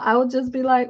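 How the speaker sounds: normal talking voice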